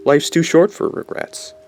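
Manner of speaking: carefree boast. Retold or imitated. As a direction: toss it off like nothing matters. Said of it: "Normally I'm like".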